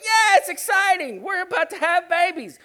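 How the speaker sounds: bright, high and laughing with joy; excited. Retold or imitated. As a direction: gush about the babies coming, laughing. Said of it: "we were like".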